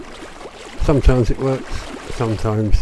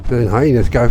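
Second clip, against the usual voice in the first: ordinary delivery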